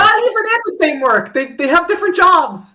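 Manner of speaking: high, loud, insistent correction; emphatic exclamation. Real, not acted. Co-host exclaims the two characters share nothing, high and loud. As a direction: cut in loudly, almost laughing in disbelief. Exasperated correction about the ship.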